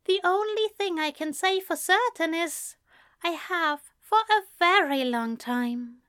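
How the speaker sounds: high-pitched, careful angel voice; Aziraphale, earnest. A narrator's reading. Angel admits a long-held love, earnestly.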